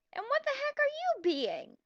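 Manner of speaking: high, strained disbelief; exasperated squeak. Real, not acted. Reader squeals her disbelief at the mean heroine.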